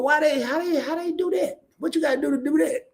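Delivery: mock bewilderment, rising; baffled questions.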